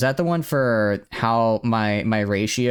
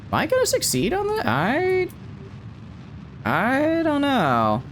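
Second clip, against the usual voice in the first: squeaky unsure. Acted. Unsure mid-flight, mock worry.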